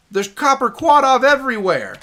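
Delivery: loud, high-pitched cry of frustration; frustrated yell at being swamped; exasperated outcry. Real, not acted. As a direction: wail it out, fed up. Exasperated at enemies everywhere.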